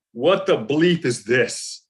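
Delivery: slow, emphatic mock outrage; appalled disbelief. Agent holds up the actor's photo, slow appalled disbelief. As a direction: imitate an agent appalled, each word drawn out.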